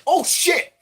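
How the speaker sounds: sudden high yelp of alarm; jumpy high-pitched surprise; startled oh shit. Retold or imitated. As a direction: caught off guard mid-fight. Startled as reptilian grabs him.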